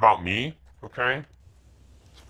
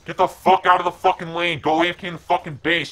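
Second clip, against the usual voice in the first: loud, higher frustrated commands at the team; angry yelling; 6 semitones higher, 6 dB louder than usual. Real, not acted. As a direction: bark orders at useless teammates. Yelling orders at teammates.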